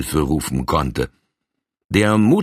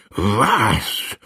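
an outraged, raised "Was?"; flaring up; 3 semitones higher than usual. Acted. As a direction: bristle with sudden outrage. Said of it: "brauste der Colonel auf".

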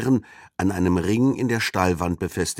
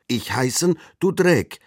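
put-on contradicting boy; 7 semitones higher, 4 dB louder than usual. A narrator's reading. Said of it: "widersprach ihr der Junge".

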